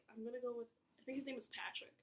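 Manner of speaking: ordinary talk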